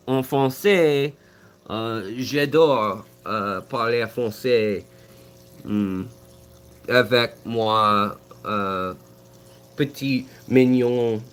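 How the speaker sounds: halting French, searching for each word; slow, hesitant French accent; speaking French. Real, not acted. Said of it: "I like talking French".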